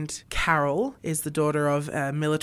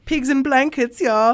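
mock German pig accent; 8 semitones higher, 7 dB louder than usual. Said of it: "the three little pigs in the Shrek movies".

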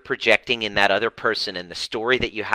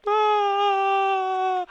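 high crying shout; imitated wail. Client breaks down before coach, wailing. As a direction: let out a mock wail. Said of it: "She starts bawling".

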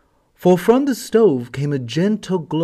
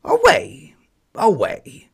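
much higher, louder barking call; hoarse dog bark; 9 semitones higher, 5 dB louder, slower than usual. Acted. Yard dog barks farewell to winter, high and loud. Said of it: "barked the hoarse yard dog".

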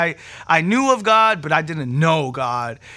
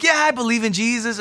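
offhand agreement; 7 semitones higher than usual. Retold or imitated. Retelling delivery guy's offhand reply.

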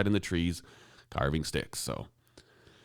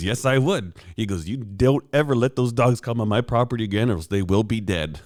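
mock threat; 4 semitones higher, 5 dB louder than usual. Neighbor's firm threat retold.

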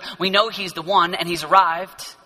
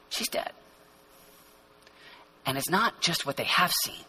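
low, soft and grave, with long pauses; hushed; 9 semitones lower, 10 dB quieter than usual. Real, not acted. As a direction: drop right down and let each phrase hang. Hushed explanation of the mourners' doubt.

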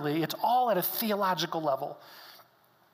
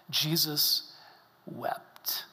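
hushed whisper; 4 semitones lower, much slower than usual. Shown as a demonstration. Preacher reads "Jesus wept" hushed.

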